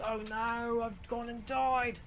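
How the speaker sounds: high, loud, slow mock-British wail; hammed-up British lament at dying; mock British dismay. Gamer after dying, mock British wail. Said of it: "British accent".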